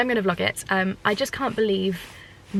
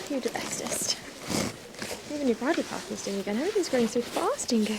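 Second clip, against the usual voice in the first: pet talk; 5 semitones higher, 7 dB quieter than usual. Real, not acted. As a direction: murmur sweet nothings to the horse. Whispered praise to horse.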